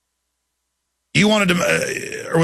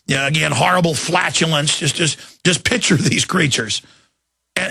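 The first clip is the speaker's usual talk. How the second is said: ordinary talk, nothing marked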